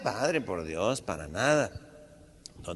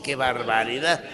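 ordinary talk

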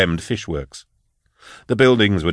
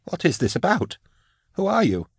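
much higher, anxious pleading; frightened questions; 11 semitones higher, 3 dB quieter than usual. A narrator's reading. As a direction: ask it high and panicky. Frightened man questions intruder.

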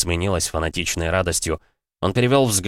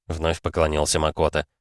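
ordinary delivery

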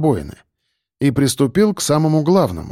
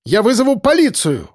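high raised threat; shouting; 8 semitones higher than usual. Acted. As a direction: shout it out, voice jumping higher. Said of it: "закричал тот".

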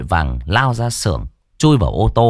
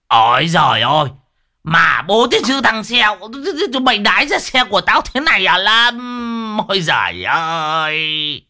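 high, wailing exasperation; hammed-up horrified scolding; appalled outrage; 10 semitones higher than usual. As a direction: shriek in disgust at your friend, ending in a long groan. Discovers friend wet himself in car.

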